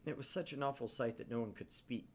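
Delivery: normal talking voice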